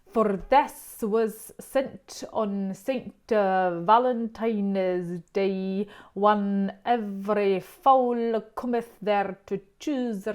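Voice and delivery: slow, loud Chaucer recital with Middle English vowels, a touch Scottish; Middle English reading. Shown as a demonstration. Reading Chaucer's original lines aloud to viewers, slow and old-fashioned. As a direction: intone "For this was sent on Saint Valentine's" slowly, the way Chaucer wrote it. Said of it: "Was that a bit Scottish?".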